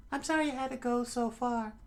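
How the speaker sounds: very high, put-on girlish voice; squeaky falsetto thanks; falsetto lady. Kaya thanks her butler for the order, falsetto lady voice.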